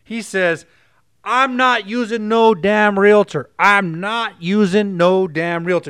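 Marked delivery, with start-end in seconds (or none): 1.2-5.9 slow, louder stubborn husband; stubborn refusal. Retold or imitated. Husband refusing his wife's plea for a realtor, slow stubborn. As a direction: dig in, arms crossed, no budging. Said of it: "he crosses his arms ... closed off".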